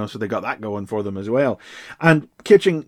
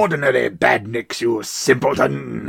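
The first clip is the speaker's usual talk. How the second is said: lightly voiced scornful villain; Robotnik voice. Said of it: "His voice comes out".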